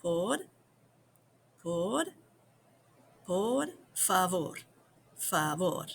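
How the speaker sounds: Por repeated slowly before por favor; slow teaching demo. Shown as a demonstration. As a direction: build the phrase up syllable by syllable. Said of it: "Go ahead and try it".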